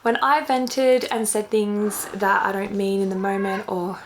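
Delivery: ordinary talk, nothing marked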